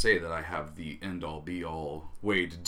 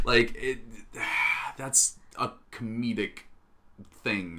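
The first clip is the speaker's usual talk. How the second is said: normal talking voice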